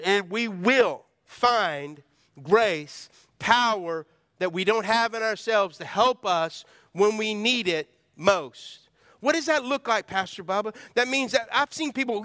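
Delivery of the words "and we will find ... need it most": slow, stressed "will"; slow emphatic reading. Shown as a demonstration. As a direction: read slowly, leaning on "will". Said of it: "here's the other one".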